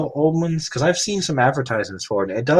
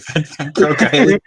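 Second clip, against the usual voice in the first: laughing; 4 semitones higher, 4 dB louder, much slower than usual. Real, not acted.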